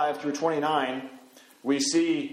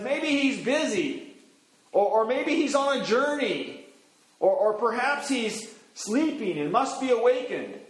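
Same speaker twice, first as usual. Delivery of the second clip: higher, teasing list of excuses; mocking taunt; 6 semitones higher than usual. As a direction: taunt the god's absence. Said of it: "he's really mocking Baal here".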